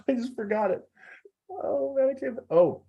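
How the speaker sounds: real embarrassed fluster, high-pitched; groping for a forgotten name in a high voice; flustered blanking. Real, not acted.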